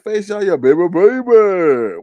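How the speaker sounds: high, slow mocking croon; mock cooing. Mocking Face Yaya's birthday, slow cooing taunt. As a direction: stretch baby out teasingly. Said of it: "I was like".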